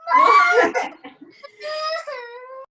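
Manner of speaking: loud high laughing shout; burst of laughter. Real, not acted. Laughing mid-workout.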